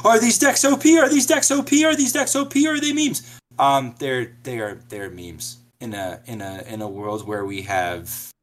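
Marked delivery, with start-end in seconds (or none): 0.0-3.4 much higher, louder eager questioning; excited friend voice. Retold or imitated. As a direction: play the pestering friend, pitched way up. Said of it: "who are just like".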